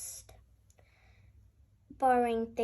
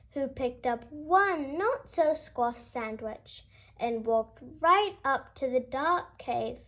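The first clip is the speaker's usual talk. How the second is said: ordinary delivery, nothing marked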